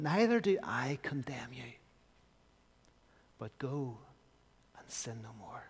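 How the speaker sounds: gentle, fading to a whisper; tender whisper. Acted. Jesus tenderly whispering forgiveness to the woman. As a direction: forgive her softly.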